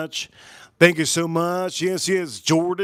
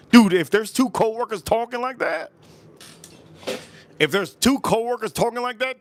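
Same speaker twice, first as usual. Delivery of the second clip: friend's raised voice; 7 semitones higher than usual. Retold or imitated. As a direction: voice the friend higher, with emphasis. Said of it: "He says".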